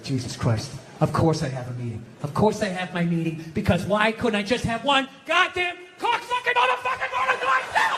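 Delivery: losing it. Acted. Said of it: "I just absolutely lost it".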